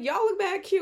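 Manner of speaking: very high, loud retold gush; excited compliment back. Woman answering teen girls on the train, loud high excitement.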